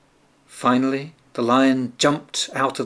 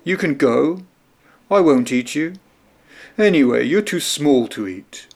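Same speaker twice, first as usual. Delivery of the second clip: lion granting mercy; 2 semitones higher, 4 dB louder than usual. A narrator's reading. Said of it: "said the lion".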